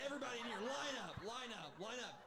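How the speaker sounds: loud, high barked orders through laughter; drill sergeant. Pretend. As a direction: yell at the painting class like a drill instructor. Bob Ross as drill sergeant.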